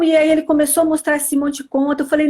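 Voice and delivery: usual speaking voice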